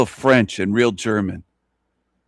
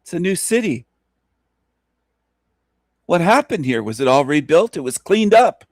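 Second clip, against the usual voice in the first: amazed visitor; 6 semitones higher than usual. Retold or imitated. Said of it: "People would be saying".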